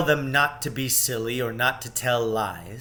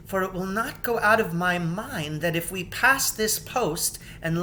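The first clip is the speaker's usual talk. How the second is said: put-on higher, slowing young queen voice; Queen Lucy's foreboding; 8 semitones higher than usual.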